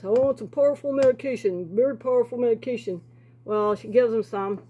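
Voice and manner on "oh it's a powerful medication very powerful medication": lightly voiced psychiatrist. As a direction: mimic the doctor, a touch grand. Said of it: "He went to a psychiatrist".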